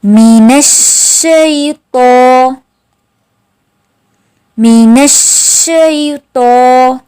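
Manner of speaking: each word drawn out slowly; slow drilled recitation. Shown as a demonstration. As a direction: chant it slowly for the class to repeat. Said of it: "मेरे साथ मशक करें". (धीरे धीरे मशक)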